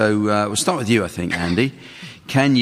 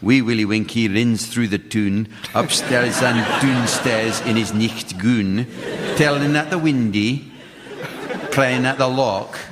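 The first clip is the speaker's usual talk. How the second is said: put-on Scots accent. Host reads out a Scottish nursery rhyme, put-on Scots accent. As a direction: recite it in a laboured Scottish accent. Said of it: "Trying to do an accent that sounds like he's a jock".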